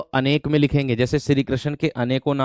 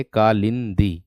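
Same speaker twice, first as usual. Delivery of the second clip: Kalindi drawn out slowly and a little lower; slow dictation; 4 semitones lower, much slower than usual. Shown as a demonstration. Teacher dictating a word to students, slow. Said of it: "इस तरीके से लिखेंगे".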